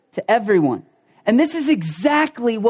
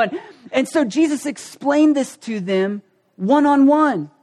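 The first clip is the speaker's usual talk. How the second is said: ordinary delivery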